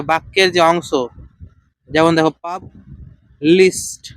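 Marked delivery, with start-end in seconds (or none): 2.3-4.1 "পাব ... list", a pause between the two parts; split into syllables. Shown as a demonstration. Teacher showing students syllable count, word split in syllables. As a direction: break "পাব list" into two separate beats. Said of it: "তাহলে এখানে কটা আছে".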